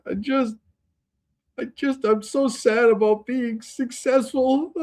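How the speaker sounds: high, slow, teary mock whimpering. Host mocking a podcaster crying about success, high mock whimper. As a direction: whine it out like a fake sob story. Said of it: "she starts, like, whimper".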